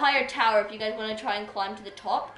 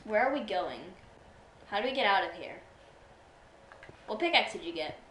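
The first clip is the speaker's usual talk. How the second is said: ordinary talk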